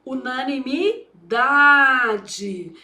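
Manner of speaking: "Unanimidade" bem devagar, com pausa antes de um DA alongado; palavra ditada. Shown as a demonstration. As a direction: dite "Unanimidade" em pedaços, esticando o DA. Dita a palavra enquanto escreve. ("Unanimidade" lento, tônica longa no DA)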